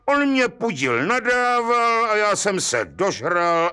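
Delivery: put-on a rough village boy justifying himself; young fellow's voice. Young thrower explains.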